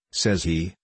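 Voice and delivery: usual speaking voice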